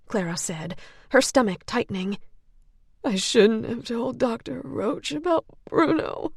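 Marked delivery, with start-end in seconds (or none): 3.0-6.3 choked-up, catching voice. Acted. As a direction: let the voice catch with tears. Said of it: "her voice catching".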